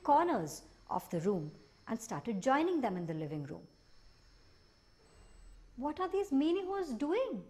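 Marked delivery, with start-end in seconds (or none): 5.8-7.4 put-on high, curious child's question; little boy voice. Little boy asking his family about the dancing creatures, high and curious. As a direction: ask it like a small wide-eyed boy. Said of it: "asked the little boy".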